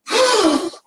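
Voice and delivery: very high, loud astonished gasp; sharp gasp. Retold or imitated. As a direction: gasp as if struck by surprise. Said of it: "and she went".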